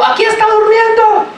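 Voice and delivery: high, shouted discovery call; mock shout calling to the others; excited shout. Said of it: "pegó el grito".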